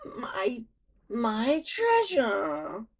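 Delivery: a villain wailing over his lost treasure; crying. A narrator's reading. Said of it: "he cried".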